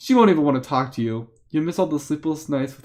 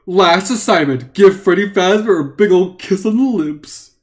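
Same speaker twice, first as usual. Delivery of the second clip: slow, loud, drawn-out reading; milk every word of the silly task; playful, stretched-out emphasis; hammed-up announcement; 3 semitones higher, 6 dB louder, slower than usual. Reader hams up a silly assignment.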